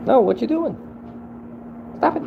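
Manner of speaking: shrieking protest. Real, not acted. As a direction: squeal at them to stop. Alarmed at someone's actions.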